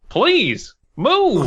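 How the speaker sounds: pleading. Acted. Voicing Jeff's plea.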